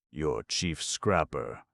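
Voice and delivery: ordinary delivery, nothing marked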